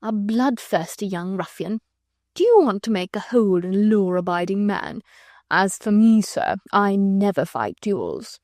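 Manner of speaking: unhurried, amused and idle; leisurely. Acted. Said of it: "he said leisurely".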